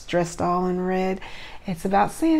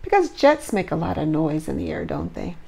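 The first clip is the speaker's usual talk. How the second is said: usual speaking voice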